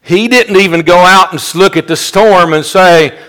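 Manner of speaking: preacher building toward the climax, loud; fiery preaching. Acted. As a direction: drive the point home to the congregation. Preaching at full force.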